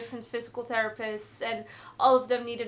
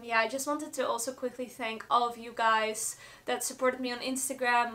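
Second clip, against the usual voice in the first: ordinary talk, nothing marked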